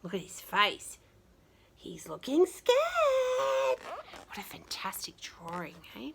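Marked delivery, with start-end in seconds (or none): 1.8-3.8 mock scared. Voicing scared face in picture.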